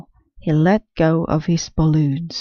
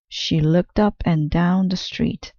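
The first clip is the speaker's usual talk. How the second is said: usual speaking voice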